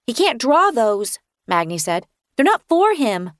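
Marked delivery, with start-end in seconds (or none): 0.1-1.2 lightly voiced small boy, slow; a stubborn little boy insisting; child voice. Magni refusing narrator, small stubborn child voice. 2.3-3.3 put-on high, louder stubborn small boy; a little boy laying down the rule; child voice. Magni telling narrator, high stubborn child voice.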